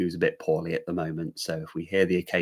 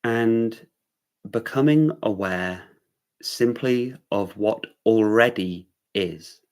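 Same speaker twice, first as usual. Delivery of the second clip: unhurried meditation-teacher delivery; guided meditation. Real, not acted. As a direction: guide them gently into awareness, leaving room between phrases.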